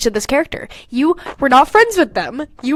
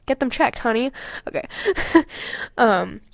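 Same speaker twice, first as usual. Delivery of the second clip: ordinary talk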